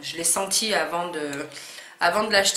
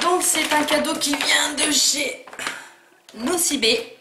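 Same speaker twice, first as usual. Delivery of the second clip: excited; 8 semitones higher, 5 dB louder than usual. Real, not acted. Excited gift presentation.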